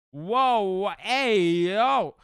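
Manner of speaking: loud, high, stretched-out reaction; shocked objection. Real, not acted. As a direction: call out in startled protest. Reacting to shocking chat message.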